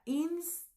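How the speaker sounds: "instinto" split ins... tin... to, with long pauses; divided into syllables. Shown as a demonstration. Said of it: "se dividirá de esta manera".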